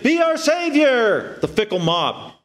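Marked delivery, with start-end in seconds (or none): 0.0-1.4 high, slow drawn-out cry; fervent plea, pitched way up; acclaiming crowd. Retold or imitated. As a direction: hail him like a crowd. Voicing the crowd hailing Jesus.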